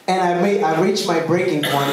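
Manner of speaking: ordinary talk, nothing marked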